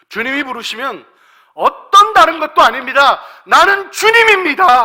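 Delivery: high, loud declaration of resolve; impassioned preaching. Real, not acted. Preacher voicing a believer answering the Lord's call, full-force declaration. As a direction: shout it as a wholehearted commitment.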